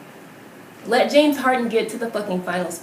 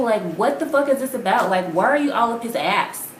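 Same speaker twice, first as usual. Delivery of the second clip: ordinary talk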